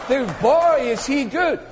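high, exclaiming spectator; mock admiration. Onlooker marveling at a flashy player, mock admiration. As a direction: exclaim it high, starting with a burst.

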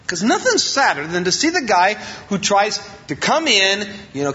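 ordinary delivery

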